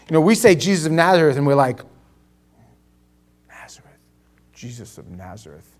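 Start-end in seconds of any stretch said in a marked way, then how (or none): 3.5-5.7 very soft, low awe; low, near-whispered holy name; hushed mock reverence. Mimics reverent saying of the name.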